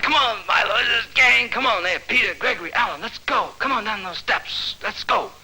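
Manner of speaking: high, urgent rounding-up call. Acted. Leader calling the gang down the steps, urging.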